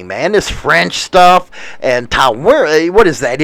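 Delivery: usual speaking voice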